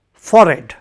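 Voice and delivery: "Forehead" said as FOR-ed, no H. Shown as a demonstration. Teacher modelling the word for the class, "Forehead" as FOR-ed. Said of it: "but it is forehead".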